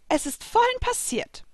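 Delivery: very high-pitched character voice; Virgo. A narrator's reading. Servant spirit explaining to her queen, high voice. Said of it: "erzählte Virgo".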